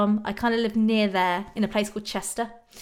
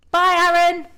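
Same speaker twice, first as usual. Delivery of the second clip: bright called goodbye; 8 semitones higher, 8 dB louder than usual. Real, not acted. Calling goodbye to viewer.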